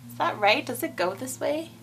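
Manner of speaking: usual speaking voice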